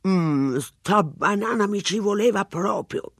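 slow, chewing policeman voice; man eating. A narrator's reading. Policeman on the line, chewing a banana. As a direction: talk through a mouthful. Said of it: "disse una voce maschile".

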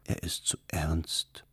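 slow, soft whisper. A narrator's reading. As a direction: whisper it behind a curtain, unhurried. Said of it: "flüsterte die Araberin".

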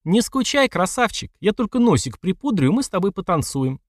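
high, sweetly flirty lilt; joyful, teasing. A narrator's reading. Sister teasing her opponent as she leaves, joyfully. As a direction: tease with a smile in the voice. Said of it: "радостно улыбнувшись, произнесла".

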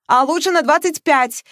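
rushing on, high and loud, not letting her speak; eager insistence. Acted. Настойчиво и взахлёб. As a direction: Veronika pushes her point on her friend, eagerly. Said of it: "не давала вставить слово подруга".

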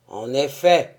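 low, loud stage tyrant. A narrator's reading. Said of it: "ajouta le tyran".

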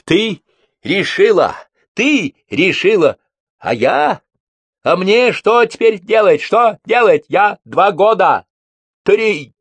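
loud, very high, desperate shouting; anguished outburst. A narrator's reading. Bibichev bursts back into the room, anguished shouting. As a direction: hurl each phrase out in high-pitched anguish.

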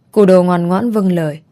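ordinary delivery, nothing marked

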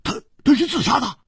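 high, stammering disbelief; flustered, rapid and incredulous; cutting in urgently. Acted. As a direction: blurt out the questions, tripping over words. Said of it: "就抢着说".

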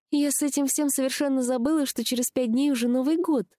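high-pitched, mildly sad lament; a touch of sadness, pitched high. A narrator's reading. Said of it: "грустно сказала она".

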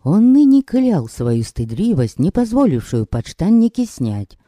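ordinary delivery

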